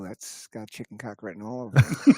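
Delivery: low-pitched mock appraisal after a sniff; mock bark sniffer. Guy cutting bark and sniffing it, mock appraisal. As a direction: play a low-voiced tree inspector.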